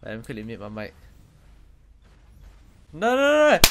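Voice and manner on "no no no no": high, loud panicked shriek; panicked screaming. Pretend. Caught by angry mom, screaming.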